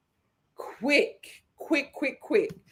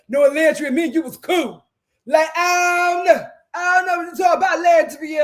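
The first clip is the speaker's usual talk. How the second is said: louder mock Coco denial; imitating Coco; 4 dB louder than usual. Host mimicking Coco denying it to Landry. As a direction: play Coco brushing it off, fast at the end. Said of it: "That's how she was acting".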